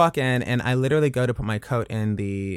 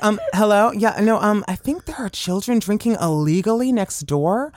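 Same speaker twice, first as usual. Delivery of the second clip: put-on, higher-pitched complainer; prim mock caller voice, raised in pitch; concerned-neighbor phone voice; 5 semitones higher than usual. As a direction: play a Karen reporting the kids next door. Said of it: "the voice you were doing on the phone".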